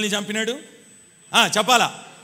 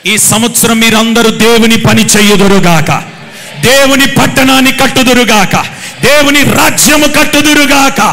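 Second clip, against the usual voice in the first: full-force preaching; 16 dB louder than usual. Real, not acted. Preacher blesses the congregation for the year, proclaiming at full force. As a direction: proclaim a blessing over the crowd.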